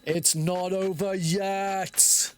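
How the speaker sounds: slow, drawn-out hammed-up villain line; mock-dramatic boss taunt; dramatic game quote. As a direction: stretch it out like a melodramatic game character. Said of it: "quoting the game line".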